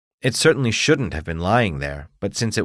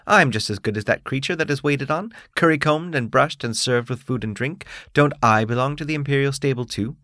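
proud, indignant beetle, pitched higher; haughty bug demanding his due; 6 semitones higher than usual. Acted. Beetle figure's complaint.

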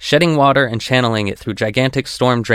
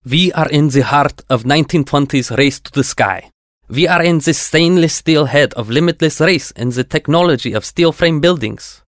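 put-on thick Russian accent, raised pitch; 4 semitones higher than usual. A narrator's reading. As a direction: speak English with a heavy Russian accent. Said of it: "with a thick Russian accent".

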